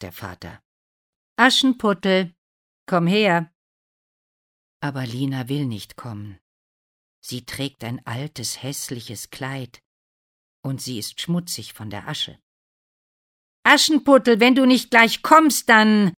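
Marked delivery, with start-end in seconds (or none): 1.4-3.5 loud, raised summons; calling out. A narrator's reading. Father summons Aschenputtel to the prince, calling out. 13.6-16.1 high, very loud threatening shout; angry yell. A narrator's reading. Parent threatens Lina who won't come, yelling angrily. Threatening call to reluctant Cinderella.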